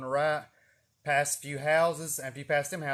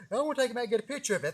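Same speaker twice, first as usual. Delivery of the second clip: rushed; 7 semitones higher, much faster than usual. Retold or imitated. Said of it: "I was like".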